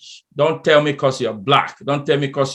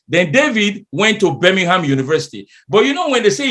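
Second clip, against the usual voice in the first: ordinary delivery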